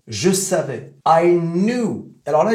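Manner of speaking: normal talking voice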